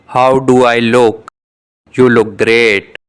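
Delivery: ordinary talk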